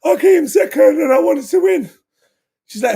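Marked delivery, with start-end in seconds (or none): 0.0-1.9 put-on high shouted child's whine; imitating crying kid. Father voicing son telling teacher he wanted to win, high shouted whine. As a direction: wail it like a heartbroken little boy. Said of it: "He said".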